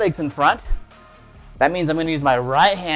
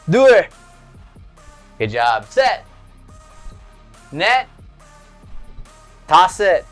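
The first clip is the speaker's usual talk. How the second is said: shouted count; 3 dB louder, slower than usual. Shown as a demonstration. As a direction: call the numbers out. Shouted count during lunges.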